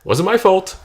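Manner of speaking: sudden loud defensive blurt; a joking cry of innocence; loud protest. Real, not acted. Defensive outburst after laugh.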